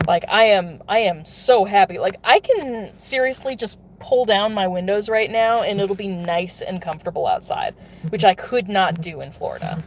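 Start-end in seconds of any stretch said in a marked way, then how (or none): none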